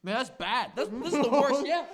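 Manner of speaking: laughing through the pain; pained laugh while talking. Real, not acted. Laughing in pain from hot chip.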